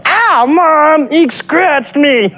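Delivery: tattle to Mom in a cartoon whine; whiny little sister.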